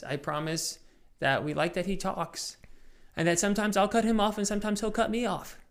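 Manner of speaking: usual speaking voice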